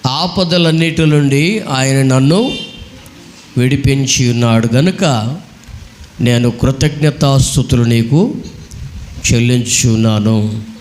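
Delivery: slow, drawn-out verse reading; scripture reading. Real, not acted. Preacher reads the psalm to the congregation, slow scripture reading. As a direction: read the verse in long, measured phrases.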